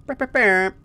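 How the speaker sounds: high playful brr brr noise; imitated hammer noise. Gamer imitating Hammer Bros hammers. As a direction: make the weapon noise.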